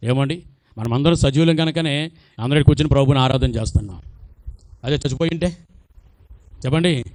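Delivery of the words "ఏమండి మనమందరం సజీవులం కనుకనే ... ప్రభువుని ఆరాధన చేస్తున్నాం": usual speaking voice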